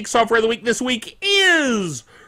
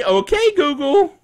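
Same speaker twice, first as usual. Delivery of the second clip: high, bright call to the phone, much higher; chirpy voice command; 7 semitones higher than usual. Retold or imitated. Host showing listeners how to say it, in a high chirpy call. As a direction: sing it out to wake the phone. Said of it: "now you say".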